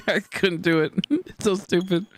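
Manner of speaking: amused, laughing between phrases; real chuckling at a silly joke; laughing too hard. Real, not acted. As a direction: say it through giggles. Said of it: "I was laughing too hard".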